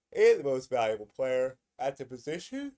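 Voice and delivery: yawning. Real, not acted. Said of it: "Sorry for the yawn".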